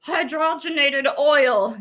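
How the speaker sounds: lightly voiced pondering inventor, slow on the last words; a hint of a thoughtful old doctor wondering aloud; mild mock scientist voice, drawn out at the end; Doctor Kellogg musing. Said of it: "That's probably how he sounded".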